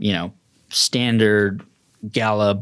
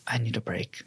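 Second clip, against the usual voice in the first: weary aside; 11 dB quieter than usual. Acted. Attendant exhausted to colleague.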